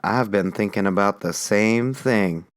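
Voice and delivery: slow, low drowsy reply; just woken, still half asleep; sleepy. Acted. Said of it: "Lincoln said sleepily".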